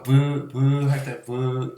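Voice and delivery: 브 said with lips pressed and released; 브 popped from closed lips; bilabial demo. Shown as a demonstration. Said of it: "입술에서 소리가 나는 거야".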